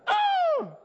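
very high, loud imitated cry; a squeal of greedy delight; mock exclamation. Mimics roommate's eager reaction.

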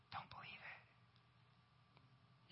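slow, soft whisper of God's reassurance. Acted. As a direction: breathe it gently into their ear. Voicing God's gentle reply.

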